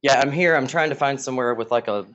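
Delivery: ordinary talk, nothing marked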